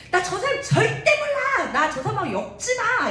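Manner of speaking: sudden high, loud snapping denial; angry outburst. Retold or imitated. Peter snapping at the accusers that he never knew him, angry outburst. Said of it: "막 버럭하면서".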